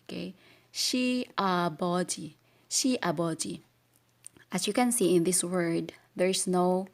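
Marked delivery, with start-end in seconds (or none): none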